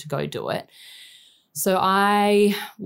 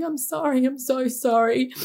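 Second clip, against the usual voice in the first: high, breaking voice, pleading sorry; sobbing apology; 7 semitones higher than usual. Retold or imitated. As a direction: apologise through tears, voice cracking high. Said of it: "screaming, like, so upset".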